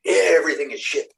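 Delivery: mock angry repeat. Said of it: "one time she got so mad".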